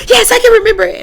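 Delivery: eager yes. Retold or imitated. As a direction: answer him bursting with eagerness. Eager answer to her hero.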